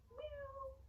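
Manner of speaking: very soft, high mew; kitty meow. Shown as a demonstration. Teacher playing little kitty cat for kids, high soft meow. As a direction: mew like a tiny kitten. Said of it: "like a little kitty cat".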